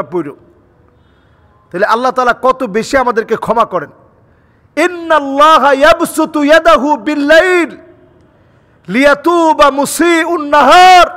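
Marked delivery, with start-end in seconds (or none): 4.8-11.1 raised, ringing hadith recitation with long-held vowels; Arabic recitation. Real, not acted. Preacher quoting hadith to the congregation, high sustained recitation.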